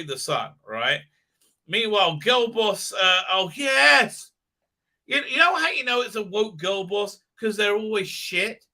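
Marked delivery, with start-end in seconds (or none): none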